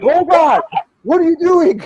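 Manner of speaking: high, loud disbelieving shout; panicked outcry. Retold or imitated. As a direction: yell at someone who has lost his mind. Passengers reacting to driver yelling.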